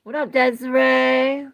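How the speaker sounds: high, loud shout-out; loud greeting. Real, not acted. Shouting out a viewer in the chat, loud. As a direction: call her name out big.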